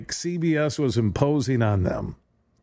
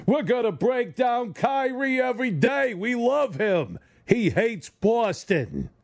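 mock radio hosts; 8 semitones higher, slower than usual. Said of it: "It's like Taz and the Moose".

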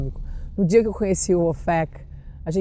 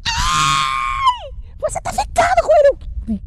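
much higher excited squeal; imitating a fan squealing about the new boyfriend; slower than usual. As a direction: gasp it out like a thrilled gossiping fan.

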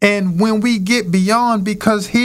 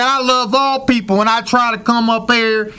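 much higher, heated Cleveland man; thick Cleveland accent; 7 semitones higher than usual. Retold or imitated. Quoting the Cleveland man on love and fighting, high heated accent. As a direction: imitate a fired-up guy from Cleveland. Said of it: "in his thickest accent is saying".

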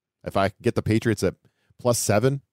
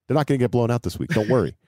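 ordinary delivery

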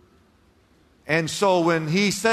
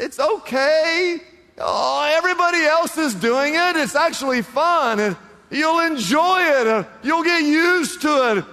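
coaxing Satan; 12 semitones higher, 5 dB louder than usual. Pretend. Satan voiced, tempting.

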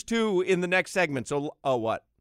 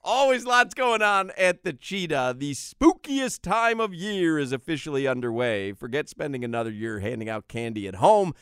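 ad read; 7 semitones lower than usual. Acted. Reads club commercial.

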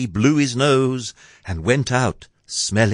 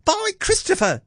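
very high, shocked lad; boy crying out. A narrator's reading. Jack shocked at the giant's meal, boy crying out. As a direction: squeal it in astonishment. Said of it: "Jack cried".

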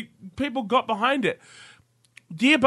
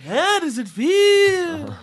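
[name] voice; 6 semitones higher, much slower than usual. Pretend. Quoting Dylan lyric after impression.

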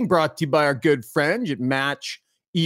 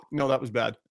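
usual speaking voice

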